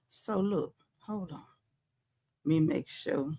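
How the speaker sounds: dropping to a near whisper off mic; hushed aside while fixing the stream; muttered aside. Real, not acted. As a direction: mutter it to yourself, half under your breath.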